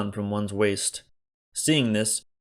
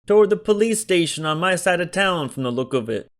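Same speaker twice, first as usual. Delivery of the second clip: higher, louder shopkeeper voice; whiny city shopkeeper; 8 semitones higher, 5 dB louder than usual. A narrator's reading. Joe tells Berkeley where the squad went. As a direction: tell it with a city whine.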